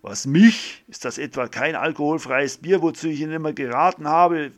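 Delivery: loud, high, indignant, speeding up at the end; angry retort. Acted. Doctor angrily answers the priest's jab about drinking. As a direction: snap back, furious. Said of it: "entgegnet der Arzt wütend".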